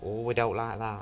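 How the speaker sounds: lightly mocked audience grumble; disapproving audience. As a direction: imitate a crowd taking mild offence. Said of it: "then the audience sort of go".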